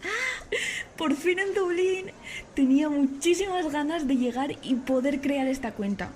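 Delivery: high, delighted arrival greeting; excited vlog opening. Acted. Eva greets followers on reaching Dublin, excited. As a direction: open brightly with a high, thrilled rush of relief. Starts recording her first upbeat story.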